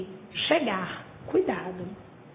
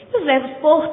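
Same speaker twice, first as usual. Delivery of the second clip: ordinary delivery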